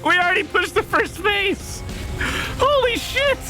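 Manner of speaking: can't believe the damage; real gamer hype; excited. Real, not acted. Boss melting fast.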